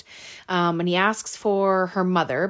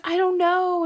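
much higher, louder mock-panicked wail; retold scared crying; 13 semitones higher, 6 dB louder than usual. Terrified girl answering the killer's quiz, high mock panic. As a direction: imitate a frightened girl wailing. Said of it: "she's so scared and she's crying".

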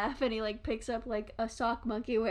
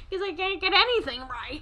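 higher mock whine; mock self-pity; 6 semitones higher, 3 dB louder than usual. Teased about accents, mock self-pity. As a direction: complain with playful self-pity.

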